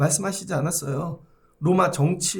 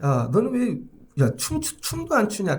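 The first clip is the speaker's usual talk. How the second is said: Jesus scolding; 4 semitones higher than usual. Retold or imitated. Preacher voicing Jesus chiding a crowd that will not dance, mock scolding. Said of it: "예수님이 말씀하시는 거죠".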